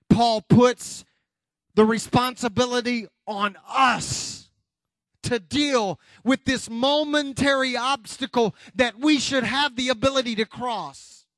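punchy phrases split by pauses; forceful preaching. Real, not acted. Preacher telling congregation to move on, forceful preaching. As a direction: preach it in short, emphatic bursts.

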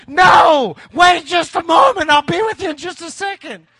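high, very loud comic blow-up; re-enacted snapped-patience outburst; exasperated shout. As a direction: lose it and yell at the drive-thru speaker. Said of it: "She pushed my button".

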